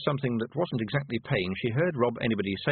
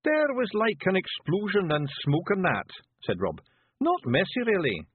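high, rough little Scottish pictie, gently; a tiny Scots warrior breaking bad news; Feegle voice; 9 semitones higher than usual. Rob's Feegle voice.

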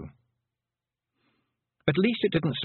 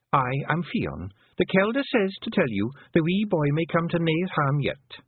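put-on a small proud Feegle girl, delivering her message; hint of a wee Scots pixie; pixie woman; 7 semitones higher than usual. Feegle woman Fion speaks.

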